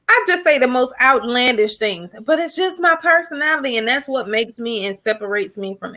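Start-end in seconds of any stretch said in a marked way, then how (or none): none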